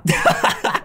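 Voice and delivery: high unvoiced laughing squeak; airy squealing laugh; breathless squeal. Real, not acted. After catching a player.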